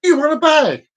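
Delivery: high, pushy mock cashier; mock over-keen till worker; too-eager offer. Shown as a demonstration. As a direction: blurt the offer straight out. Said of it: "I wouldn't immediately say".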